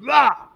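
sudden loud, high "Ah!"; imitated dog bark. Guest imitates his dog on a walk, sudden yap. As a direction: burst out like a dog with no filter. Imitates a dog's unfiltered outburst.

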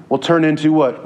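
usual speaking voice

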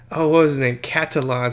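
usual speaking voice

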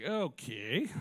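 tearful little laugh; choked-up laugh. Real, not acted. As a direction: laugh it off, moved. Overcome by dad's message.

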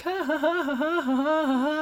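high, canned sitcom laughter, ha ha ha; mock a tinny studio audience laughing; imitating a laugh track. Mocking the sitcom laugh track during a violent scene, high canned laughter. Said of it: "the laugh track is just going".

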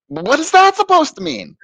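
high-pitched, baffled challenge; incredulous question. Retold or imitated. Teenager challenging the priest's answer, incredulous. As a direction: ask it in retold, exasperated disbelief.

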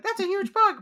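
high, loud shocked cry; shocked exclamation. Retold or imitated. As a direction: react like a huge bug appeared. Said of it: "I'd be like".

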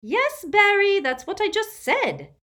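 high, loud irritated snap; impatient old aunt snapping; testily. Acted. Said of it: "said Aunt Muriel testily".

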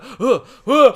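high, loud mock panting; frightened herd animal panting as it bolts; panicked panting. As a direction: pant like a spooked animal. Spooked herd animals fleeing.

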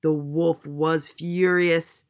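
loud, slow emphasis; heavy, drawn-out outrage; furious narration. A narrator's reading. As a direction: stretch the words out with storybook fury. Narrator voices the wolf's fury.

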